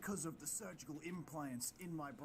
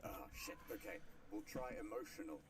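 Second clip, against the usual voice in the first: muttered villain aside; 5 semitones lower, 6 dB quieter than usual. Acted.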